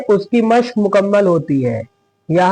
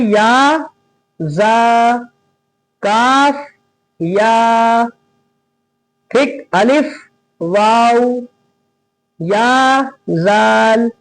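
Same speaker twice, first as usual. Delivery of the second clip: "या, जा, काफ" each drawn out alone, long pauses between; separate letters; much slower than usual. Shown as a demonstration. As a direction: recite each letter by itself like "या… जा… काफ", slowly. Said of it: "ऐसे ही पढ़ेंगे अलग अलग". ("या, जा, काफ" हर हर्फ़ खींच कर, अलग-अलग)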